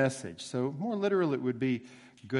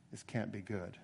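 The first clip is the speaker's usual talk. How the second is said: worried murmur; 5 semitones lower, 13 dB quieter than usual. Retold or imitated. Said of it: "you think".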